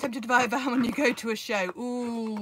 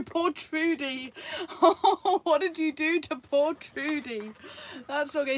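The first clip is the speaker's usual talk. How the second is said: high, slow laughing "poor Trudy"; laughing through sympathy; laughing dismay; 4 semitones higher, 4 dB louder, slower than usual. Real, not acted. Vlogger hears a viewer damaged their van, laughing.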